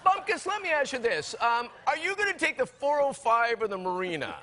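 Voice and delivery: high, mock-chummy, laughing; hamming up the new name with glee; trying the name out. Husband trying the odd name on the driver. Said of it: "And I go".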